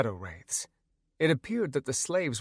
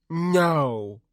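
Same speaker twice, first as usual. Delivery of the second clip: pouting; 5 semitones higher, 4 dB louder than usual. A narrator's reading. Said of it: "Wally pouted".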